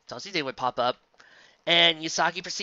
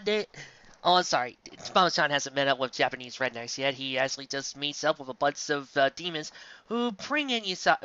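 ordinary talk

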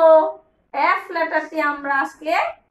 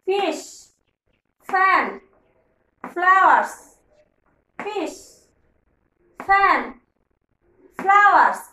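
drill for repeating; 3 semitones higher than usual. Shown as a demonstration. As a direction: chant the words for kids to echo. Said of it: "আমার সাথে সাথে বলছো তো?".

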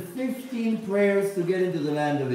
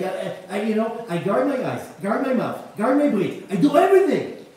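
climbing to a loud, high outcry; listing his efforts, building to loud and high; frustrated man shouting his grievance at the rabbi; upset; 4 semitones higher, 4 dB louder than usual. Retold or imitated. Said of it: "He was so upset".

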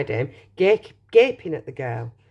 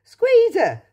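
Wonka voice; 14 semitones higher, 6 dB louder than usual. Acted. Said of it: "said Mr Wonka".

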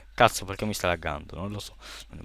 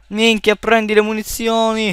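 urlo concitato, più acuto; urlato; 11 semitones higher, 6 dB louder, slower than usual. Real, not acted. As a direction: grida agli altri di prendere le munizioni. Panico in partita, urla. (urlo concitato)

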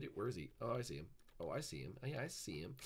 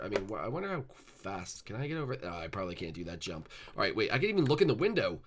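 ordinary delivery

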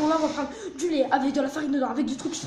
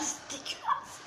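slow breathless whisper of disgust; 9 dB quieter, much slower than usual. Real, not acted. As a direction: gasp it out, barely voiced. Tasting the disgusting mix.

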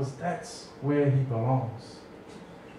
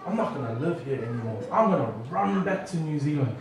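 lower, softer, fast sulky vow; a hurt boy vowing to run away; sulky mutter; 6 semitones lower, 6 dB quieter than usual. Retold or imitated. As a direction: grumble it under your breath. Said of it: "I said".

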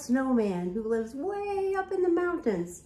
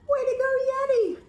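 higher, bright cheer; 10 semitones higher, 3 dB louder than usual. Acted. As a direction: cheer the Yeti on for the kids. Cheering the Yeti for kids.

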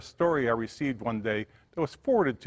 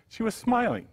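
ordinary delivery, nothing marked

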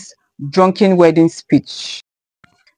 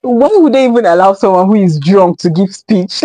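ordinary talk, nothing marked

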